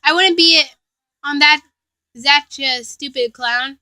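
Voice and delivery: ordinary delivery, nothing marked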